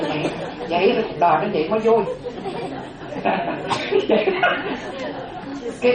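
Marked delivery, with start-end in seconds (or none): 2.1-5.8 long high breathy laugh fading down. Real, not acted. Monk laughing after the grandparents tale, high breathy laugh. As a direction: laughs warmly at the story's end.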